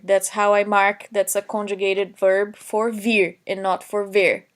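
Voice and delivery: ordinary talk, nothing marked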